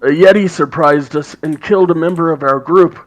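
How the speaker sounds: high, loud raspy dwarf woman; [name]'s raspy voice. Acted. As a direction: rasp it out as a gruff dwarf. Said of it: "in a raspy voice".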